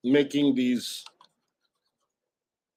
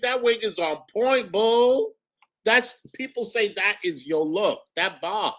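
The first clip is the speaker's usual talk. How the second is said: put-on higher, sassy girlfriend talk; sassy diva voice; 8 semitones higher than usual. Praising their own wig to chat as a sassy diva. Sassy girl persona throughout.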